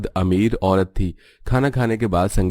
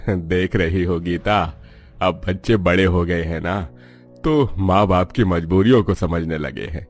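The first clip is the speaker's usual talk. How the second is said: put-on measured older parent's voice, a little lower; reflective father; 3 semitones lower than usual. Father tells his wife the children have grown, reflectively.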